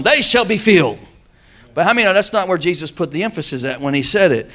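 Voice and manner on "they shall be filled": "they shall be filled" raised high; stress on THEY SHALL BE FILLED. Shown as a demonstration. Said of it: "We put the emphasis on".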